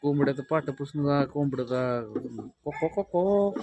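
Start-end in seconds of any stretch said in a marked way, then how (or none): 2.7-3.6 high, loud cock-a-doodle calls; mock rooster crowing, pitched up; rooster crow imitation. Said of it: "cock बागा देत असतात".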